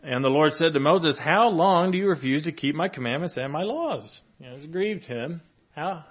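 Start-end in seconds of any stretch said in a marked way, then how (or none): 0.0-4.2 scripture reading. Acted. Reads scripture passage aloud.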